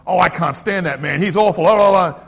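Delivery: loud mock rant tumbling into babble; ranting complainer. Complainer venting to a listening peacekeeper, loud mock rant. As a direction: act out a noisy gripe about someone. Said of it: "The peacekeeper will listen".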